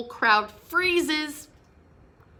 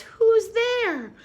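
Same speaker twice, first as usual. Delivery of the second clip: high, louder squeaky call; mouse cries out; 13 semitones higher, 6 dB louder than usual. Acted. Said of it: "Mouse cries".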